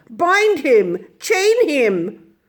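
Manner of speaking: high, loud command; crying out. A narrator's reading. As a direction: grab him now. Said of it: "they cried".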